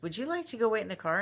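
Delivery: high, quick quoted question; imitating her companion. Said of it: "and he goes".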